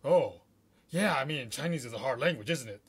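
imitating a flustered listener, loud "oh" then settling; mock surprise that eases into agreeing; taken aback. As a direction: play someone caught off guard, recovering. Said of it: "you see the shocked look".

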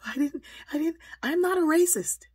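high, loud, panicked protest; stammering start bursting into a shrieked denial; frazzled teacher. Retold or imitated. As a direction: imitate a flustered teacher blurting denials. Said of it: "she's all frazzled".

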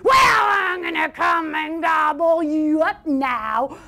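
slow, drawn-out menacing shout; troll's roar. Acted. Troll threatening to gobble up the goat, roaring. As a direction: roar a threat, dragging out the words. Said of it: "roared the troll".

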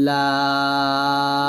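লাম held long, chanted; drawn-out lam. Shown as a demonstration. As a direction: stretch লাম in recitation style. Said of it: "এইসব উচ্চারণ". (টেনে সুর করে লাম)

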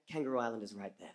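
low, soft, lightly voiced dad; a hint of a dad stating the obvious, dropping low; dismissive dad. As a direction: quote Dad quietly and dismissively. Quotes dad's dismissive excuse.

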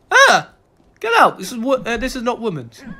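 high, loud mock outrage; alarmed shout. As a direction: order an intruder out, high-pitched. Chasing women out of men's room.

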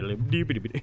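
babbled "biddly-doo" gibberish noise; imitated spazzing noise. Player mimics the spinning horse, silly babble. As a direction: make a silly rapid tongue-flapping noise.

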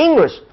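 ENGLISH emphasised, falling away after the first syllable; stress on ENGLISH. Shown as a demonstration. Teacher points out the emphasised word, stressing ENGLISH. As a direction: lean on the start of English. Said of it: "它有强调某个".

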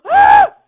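very high, loud shriek; gasp, then scream sharply; startled scream. Real, not acted. Gamer startled right after a boom, high scream.

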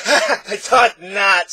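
high, slow, laughing reply; happily. Acted. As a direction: chuckle through it with glee. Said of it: "said the voice happily".

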